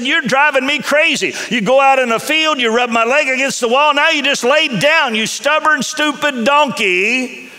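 hammed-up rage at a stubborn donkey; furious Balaam. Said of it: "but said".

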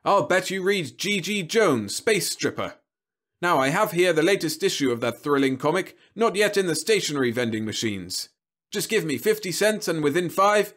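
weighty robot salesman; ponderous sales pitch. A narrator's reading. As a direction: roll out the patter like a TV star. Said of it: "Rather ponderously".

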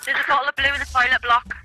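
mock British, fast. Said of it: "she teaches me the slang".

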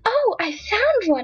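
high, drawn-out delighted exclamation; excited cry. A narrator's reading. Girl finding a chestnut among the leaves, excited cry. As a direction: cry out over a find. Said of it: "cried Nan".

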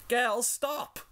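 high pleading shout; crying out. Acted. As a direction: cry out to stop them. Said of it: "she cried".

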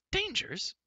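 very high incredulous echo; scornful cry. A narrator's reading. Girl pouncing on Taryn's warning, incredulous cry. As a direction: fling the word back, high. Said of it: "cried Elenwy".

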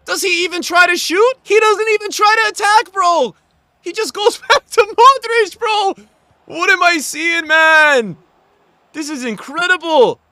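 high, loud incredulous ranting; loud disbelief. Real, not acted. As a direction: rant in amazed outrage. Disbelief at opponent's play.

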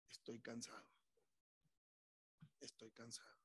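low, soft tired confession; subdued, weary plea; weary prayer. Acted. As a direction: say it quietly to God, worn out. Voices a weary person's prayer.

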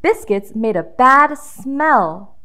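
usual speaking voice

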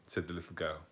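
plain reading voice

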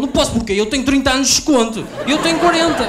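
high, loud bragging yell; old men shouting. Retold or imitated. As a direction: bellow the years like a pensioner.

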